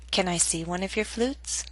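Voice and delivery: "Can I see one of your flutes?" rising at the end; rising intonation. Shown as a demonstration. Teacher modelling a yes-no question, rising intonation. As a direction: softly lift the pitch through "your flutes". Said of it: "yes-no questions use rising intonation".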